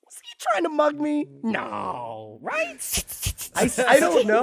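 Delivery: high, rising incredulous question; dawning alarm. Retold or imitated. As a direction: let it dawn on you mid-sentence. Sudden dawning doubt.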